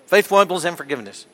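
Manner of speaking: quick-fire tongue twister; fast, run-together phrase; real fast. Shown as a demonstration. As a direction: rattle it off, hitting the first words harder. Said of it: "Real again".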